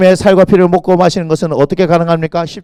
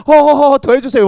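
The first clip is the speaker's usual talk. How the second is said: laughing students; 8 semitones higher, 7 dB louder than usual. Retold or imitated. Students enjoying being scolded.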